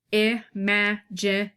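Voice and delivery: IMAGINATION broken into separate, evenly weighted syllables. Shown as a demonstration. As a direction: count out each syllable of IMAGINATION, with pauses between. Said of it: "me just counting out the syllables".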